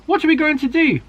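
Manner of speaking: high, loud frightened cub; panicked question. A narrator's reading. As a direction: wail it in panic. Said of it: "cried Alfie".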